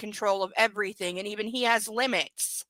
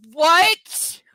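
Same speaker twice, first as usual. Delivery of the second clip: loud, high disbelieving what; shocked what; 7 semitones higher, 11 dB louder than usual. Real, not acted. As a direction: blurt it out in shock. Shocked reaction to the book.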